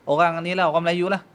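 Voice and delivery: ordinary talk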